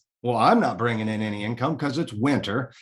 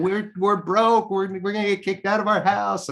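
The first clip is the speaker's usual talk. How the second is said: high, mock tearful wife; imitating his wife's panicked sobbing; crying wife; 7 semitones higher than usual. Wife crying to husband about losing the house, tearful. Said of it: "she's crying".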